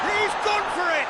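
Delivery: high-pitched excited match call; excited commentary. Real, not acted. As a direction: call the attempt with a sudden lift in pitch. Live call of attacking move.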